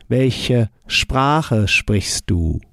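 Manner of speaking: very slow repeat of "Welche Sprache sprichst du?"; careful learner-paced German; slow model answer. Shown as a demonstration. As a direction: model the sentence again slowly. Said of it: "we carry on the same ending".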